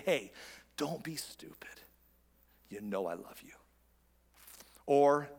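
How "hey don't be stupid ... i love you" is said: slow, whispery and soft; God's tender voice. Acted. Pastor as God speaking to a wayward listener, hushed. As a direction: hush it into an intimate, loving admonition. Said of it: "hear God's voice say".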